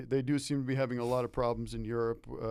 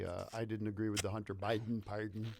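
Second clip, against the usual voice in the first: political pundit impression; 2 semitones lower, 6 dB quieter than usual. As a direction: talk like a cable news pundit. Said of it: "No, I'm just doing a, a pundit".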